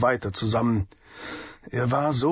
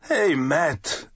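calling out; 4 semitones higher than usual. A narrator's reading. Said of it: "rief er".

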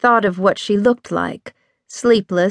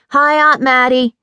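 put-on higher, louder child's greeting; young boy; 5 semitones higher, 4 dB louder than usual. A narrator's reading. Younger nephew greets his aunt, a child's higher, louder voice.

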